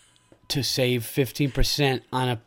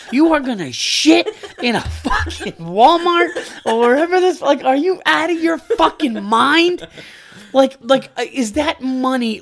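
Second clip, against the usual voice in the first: much higher and louder, shouting in disbelief; real ranting shout; 10 semitones higher, 5 dB louder than usual. Real, not acted. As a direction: yell it like you can't believe anyone would do that. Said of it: "We're sitting here screaming about shit".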